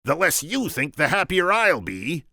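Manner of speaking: high, loud Vizzini voice; squeaky scheming Sicilian. Acted. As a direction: pitch him up, sharp and cocky.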